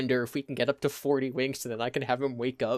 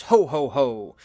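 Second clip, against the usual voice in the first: big, loud Santa ho ho ho; jolly Santa greeting Sammy; Santa laugh; 9 dB louder, slower than usual. Acted. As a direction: boom it out like Santa.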